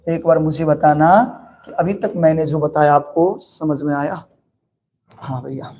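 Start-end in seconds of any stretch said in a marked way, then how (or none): 5.1-5.7 student reply. Acted. छात्र बनकर जवाब.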